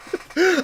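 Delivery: high breathy burst of laughter. Real, not acted. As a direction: crack up at yourself. Laughs at his own mistake.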